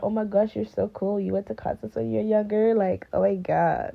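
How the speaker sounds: lightly put-on American accent. Kids admiring a parent's concert days, mock American accent. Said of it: "I switched my accent to be an American one".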